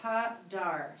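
slow, careful model reading. Shown as a demonstration. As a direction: read it slowly for a learner.

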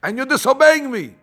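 put-on high, scolding the blinds like a disobedient child; hammed-up nurse telling off stubborn blinds; mock scolding nurse. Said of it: "That's what she's telling the blinds".

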